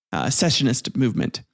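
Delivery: usual speaking voice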